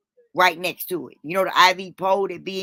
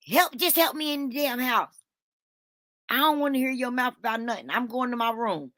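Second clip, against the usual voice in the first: high-pitched, fed-up demand; impatient order, brushing off questions; imitated irritable mom, pitched up; cranky mother; 6 semitones higher than usual.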